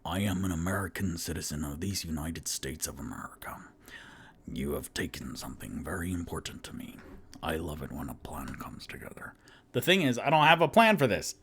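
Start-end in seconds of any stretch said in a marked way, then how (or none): 0.0-9.3 low, hushed Irish-tinged voice trying for American; breathy low menace, half whispered; Liam Neeson impression. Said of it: "my Liam Neeson impression is an Irish accent".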